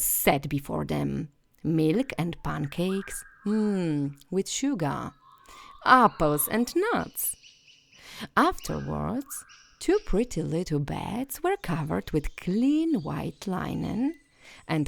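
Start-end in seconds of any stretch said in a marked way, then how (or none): none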